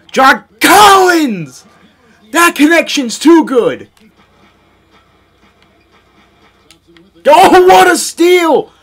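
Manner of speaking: real excited yelling; hype shout. Real, not acted. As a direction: scream it like a highlight call. Yelling at big plays.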